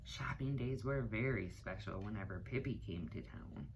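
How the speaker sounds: plain reading voice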